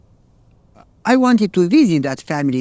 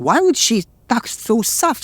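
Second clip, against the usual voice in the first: ordinary delivery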